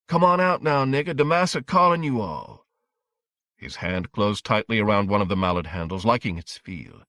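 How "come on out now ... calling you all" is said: high, mocking summons; taunting call. A narrator's reading. A hostile inner voice orders him out, taunting. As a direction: call out jeeringly, pitched up.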